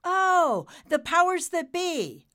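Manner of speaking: high, loud realization; excited guess. Acted. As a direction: burst out with the answer.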